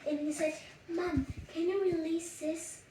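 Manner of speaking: ordinary delivery, nothing marked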